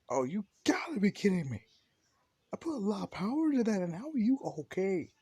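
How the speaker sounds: high, loud incredulous outburst; can't-believe-it indignant yelling; exasperated disbelief. Acted. Bakugo's outraged disbelief in retold story.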